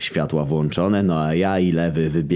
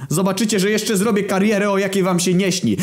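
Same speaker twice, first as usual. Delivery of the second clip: high, strained cartoon voice swearing revenge; defeated footballer vowing revenge on everyone, high and strained; vengeful vow. Vengeful vow after lost match.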